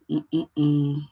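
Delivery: short repeated hums on one note; idle humming. Real, not acted. Host waiting for viewers to join, humming idly. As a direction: hum absently to fill the wait.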